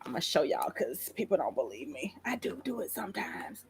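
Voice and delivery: unvoiced aside; an aside to the viewers, whispered. Real, not acted. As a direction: whisper it like a confession.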